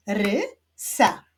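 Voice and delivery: "Rysa" as RY... SA, two separate beats; "Rysa" split, rising ry then falling sa; syllable by syllable. Shown as a demonstration. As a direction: clap out "Rysa" with a pause after ry. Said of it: "Wyklaszczmy sylaby w imionach tych kotków".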